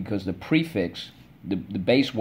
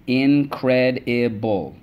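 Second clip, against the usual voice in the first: "incredible" stretched slowly as in-cred-i-ble; syllable by syllable; 3 semitones higher, much slower than usual. Shown as a demonstration. Teacher reviewing words with the class, slowly syllable by syllable. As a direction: tap "incredible" out slowly, one syllable at a time. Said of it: "Let's go".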